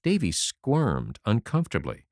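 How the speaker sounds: ordinary delivery, nothing marked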